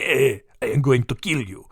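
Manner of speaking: a hammed-up Italian gangster threat; Italian accent, mock menace. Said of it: "I don't know why they're Italian".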